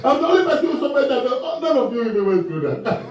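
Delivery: very fast, laughing mock complaint. Real, not acted. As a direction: rush it out, laughing at yourself. Mock self-pity, laughing.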